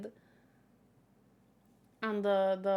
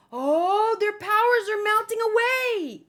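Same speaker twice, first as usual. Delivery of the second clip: higher, louder dramatic cry; dramatic melting; 9 semitones higher, 8 dB louder than usual. Acted. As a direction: wail it like the power is draining away. Narrating villains losing power.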